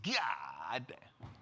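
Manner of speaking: low, hushed, drawn-out amazement; awed whisper. Retold or imitated. Storyteller impressed by Peter's mob line, awed whisper. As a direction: breathe it out in awe.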